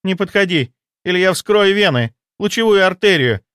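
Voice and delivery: high, urgent threat; desperate mistress. Acted. Mistress threatening to cut her veins, high, desperate warning. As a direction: warn him off in desperation. Varvara warns him off with scalpel.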